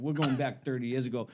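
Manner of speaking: ordinary delivery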